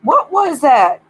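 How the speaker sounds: loud, slow startled what; a real shocked outburst. Real, not acted. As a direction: jump at a weird noise. Startled by sound.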